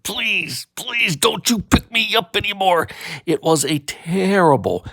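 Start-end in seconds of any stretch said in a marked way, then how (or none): 0.0-2.9 higher pleading dad voice; exasperated dad. Acted. Dad begging not to pick him up, pleading. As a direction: beg like a fed-up father. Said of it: "My dad said".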